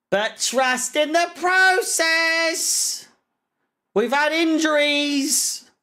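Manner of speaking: high, drawn-out mock whine; mocking imitation. Fan parroting the manager's defenders, mocking whine. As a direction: parrot the excuse slowly in a high voice.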